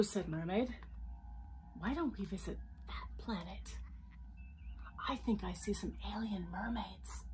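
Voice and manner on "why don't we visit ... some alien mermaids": soft secretive whisper. A narrator's reading. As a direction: whisper the idea. Mermaid whispers suggestion.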